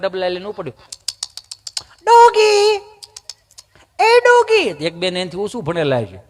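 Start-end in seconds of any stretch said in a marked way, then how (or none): 2.0-4.6 very high, sustained sing-out; mock dog calling. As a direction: call the dog like a prissy city visitor. Visitor calls out to the dog.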